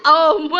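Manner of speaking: loud, sudden mock gobble; dinosaur chomp. Adult shows child how dinosaur eats, loud gobble. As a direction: chomp hugely like a hungry dinosaur. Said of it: "How dinosaurs eat?".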